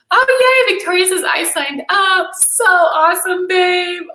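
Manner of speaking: high, loud, stretched-out yay; delighted cheer. Real, not acted. Host spots Victoria's sign-up, delighted cheer. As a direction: burst out with joy, slowly.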